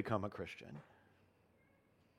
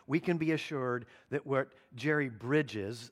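usual speaking voice

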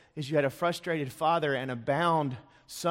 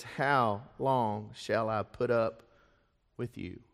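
disappointed; 4 semitones lower, slower than usual. Acted. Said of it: "with a disappointed heart".

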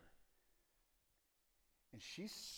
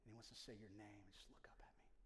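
fast unvoiced hush; whisper; 3 semitones lower, 12 dB quieter, faster than usual. Real, not acted. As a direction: softly urge them.

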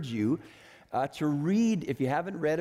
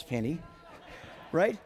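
ordinary delivery

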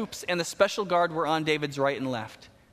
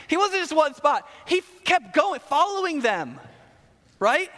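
pitched way up and louder, amazed at the persistence; excited storytelling; 10 semitones higher, 4 dB louder than usual. Real, not acted. Pastor marveling that Shimei kept following David, excited and high. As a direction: push it up high, can you believe this guy.

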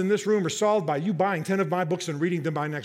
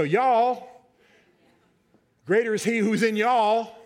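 Southern y'all; 4 semitones higher, 4 dB louder, slower than usual. Shown as a demonstration. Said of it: "Let's all go Southern".

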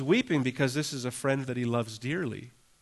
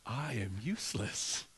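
slow, softer, dejected admission; self-doubting thought; 7 dB quieter than usual. Retold or imitated. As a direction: voice a defeated thought to yourself. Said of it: "thinking, like".

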